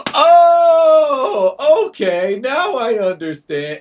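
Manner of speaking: loud, very high cry of getting it; sudden realization. Retold or imitated. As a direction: burst out with the eureka moment. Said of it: "they're like".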